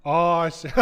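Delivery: ordinary delivery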